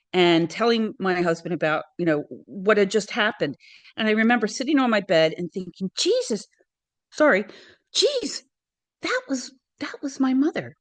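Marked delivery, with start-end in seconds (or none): 6.0-10.8 reliving the moment it clicked, much higher; stunned realization. Retold or imitated. Remembering the dream voice was her mother, awed surprise.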